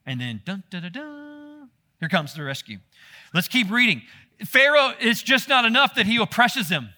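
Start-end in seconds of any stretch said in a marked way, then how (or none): 0.5-1.7 slow, hushed suspense sting; mock movie suspense sting, drawn out; dun dun dun drama sting. Dramatic drum sting before rescue.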